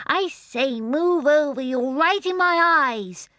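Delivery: loud, high protest, drawn out at the end. A narrator's reading. Baby John tells the sunlight to move over, loudly. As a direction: call out loudly and indignantly, high-pitched. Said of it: "in a loud voice".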